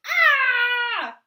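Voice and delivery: loud high cry of pain; high shocked yell. A narrator's reading. Daddy yelping as the seal baby slaps his face. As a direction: yelp like a slapped daddy. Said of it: "He yelled".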